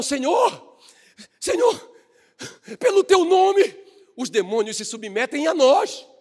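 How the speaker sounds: high, rising, breathless cry of Senhor; excited disciples. Retold or imitated. Disciples report to Jesus, high excited cry. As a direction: disciples bursting back in, thrilled and urgent. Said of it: "Os discípulos voltaram desesperados".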